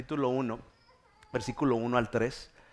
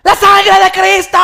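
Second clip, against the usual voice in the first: very loud, high drawn-out shriek; terrified screams; 20 dB louder, slower than usual. Acted. Mother screaming down the slide, hammed-up terror. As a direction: scream a prayer in mock terror. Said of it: "empiezo a escuchar los gritos".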